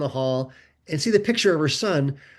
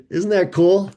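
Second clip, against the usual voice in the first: ordinary talk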